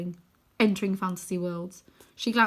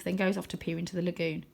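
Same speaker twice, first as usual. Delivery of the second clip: ordinary delivery, nothing marked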